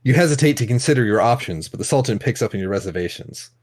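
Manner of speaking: ordinary delivery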